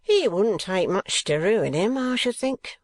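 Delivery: grinning Squeers. A narrator's reading. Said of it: "said Squeers with a grin".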